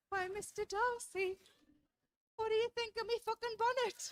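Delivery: period drama lady. Pretend. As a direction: play a mock-genteel Austen heroine. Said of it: "Gonna get that in a Pride and Prejudice".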